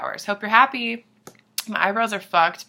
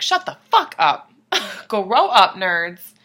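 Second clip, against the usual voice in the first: half-laughing yell to shut them up; mock yell; 8 dB louder, slower than usual. Yelling at the giggling crowd, half laughing. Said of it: "me and Raph were like".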